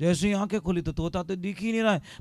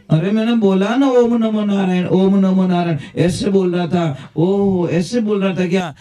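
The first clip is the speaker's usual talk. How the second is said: much louder, fervent storyteller's declaiming; preaching at full force; 10 dB louder than usual. Acted. Preacher telling devotees of Kayadu's joy, declaimed loudly. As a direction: belt the story out to the whole hall.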